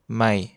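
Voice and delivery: "mai" said low and soft; Thai low tone. Shown as a demonstration. Teacher models the low tone of "mai" (new) for learners. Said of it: "qui a un ton bas".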